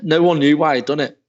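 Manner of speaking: ordinary delivery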